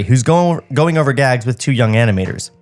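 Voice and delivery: normal talking voice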